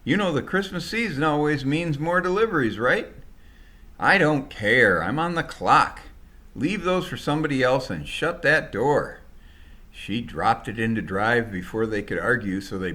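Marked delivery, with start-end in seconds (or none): 0.0-3.1 arguing shout. Acted. Packers arguing with the driver. 4.0-9.2 angry refusal. Acted. Driver shouting down the packers to shut the door.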